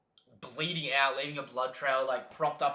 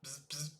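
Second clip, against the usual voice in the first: radio buzz; 13 dB quieter than usual. Retold or imitated. Imitates radio static.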